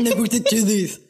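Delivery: "Nick works at Chili's" mushed together with slurred consonants; garbled mimicry. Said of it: "That's what that sounded like listening back to it".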